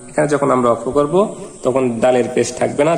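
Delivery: ordinary talk, nothing marked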